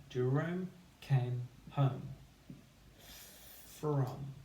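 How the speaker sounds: slow, steady dictation. Shown as a demonstration. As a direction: dictate it slowly. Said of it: "Pause, have a try".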